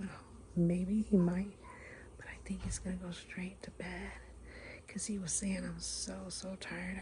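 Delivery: hushed secret whisper. Real, not acted. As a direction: whisper so he can't hear. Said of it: "whisper 'cause he can hear me".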